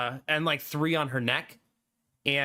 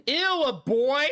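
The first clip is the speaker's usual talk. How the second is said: higher, louder, slow prissy sneer; disgusted old dog; 8 semitones higher, 4 dB louder, slower than usual. Pretend. Old dog Sadie reacting to a boy dog, prissy disgust.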